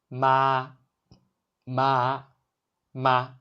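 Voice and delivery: MA held level on one steady note, no rise or fall; first tone. Shown as a demonstration. Teacher demonstrating to learners, MA in a steady first tone. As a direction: sustain MA like a sung note. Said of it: "The pitch is high and flat".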